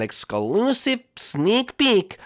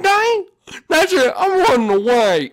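put-on much higher, louder childish outburst; outraged tantrum; 7 dB louder, slower than usual. Grounded Jeffy rebels against his parents, loud tantrum. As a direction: explode and storm off. Outraged kid runs away.

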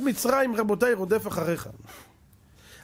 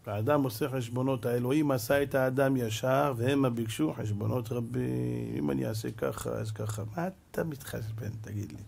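low, soft, confiding tone; subdued reproof; 9 semitones lower, 5 dB quieter than usual. Real, not acted. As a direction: drop right down to chide the listener gently. Quiet reproof after the climax. (תוכחה שקטה בקול נמוך)

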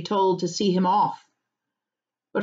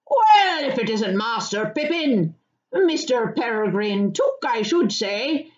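delighted cry of recognition; 4 semitones higher than usual. Acted. Farmer recognizing Pippin at the gate, delighted cry. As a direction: hail an old acquaintance with pleased surprise. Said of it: "he cried, changing from a scowl to a grin".

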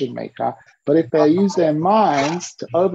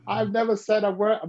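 ordinary talk, nothing marked

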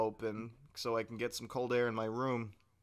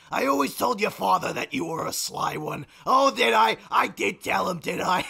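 higher and louder, a brash shouting cousin; put-on cousin voice; 4 semitones higher, 6 dB louder than usual. Prank call to Blaine, higher, louder put-on cousin. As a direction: boom it like a long-lost cousin. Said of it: "the impression I'm doing".

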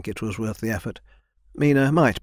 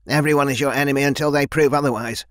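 growled; 4 semitones higher, 4 dB louder than usual. Acted. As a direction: menace him with a growling threat. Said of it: "she growled".

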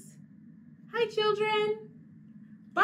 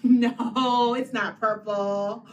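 ordinary talk

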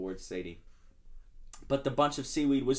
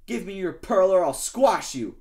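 menacing demand; 6 semitones higher, 8 dB louder than usual. Acted. Shark demanding from oyster.

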